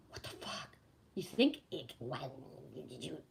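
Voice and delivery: breathless whispered disbelief. Real, not acted. To herself about the easy road, a whispered what the fuck. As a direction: mouth it almost silently in shock.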